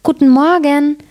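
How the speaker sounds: high, sing-out greeting; calling out. A narrator's reading. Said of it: "Es rief".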